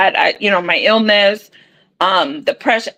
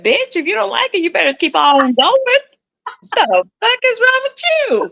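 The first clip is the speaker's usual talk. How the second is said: a high, sassy comeback to a churchgoer; sassy retort; 11 semitones higher than usual. Retold or imitated. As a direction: snap back high and cheeky. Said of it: "I said".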